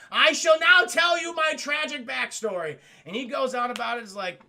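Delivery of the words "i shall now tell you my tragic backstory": loud, high, theatrical declamation; melodramatic announcement. Acted. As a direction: declaim it like a hammy tragic figure. Villain grandly announces his backstory.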